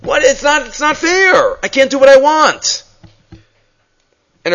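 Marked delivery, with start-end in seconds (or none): 0.0-2.8 a hint of a sulky child, higher; lightly voiced petulant son; rebellious son. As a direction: whine it like a kid denied his way. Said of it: "He thinks he's still an eved".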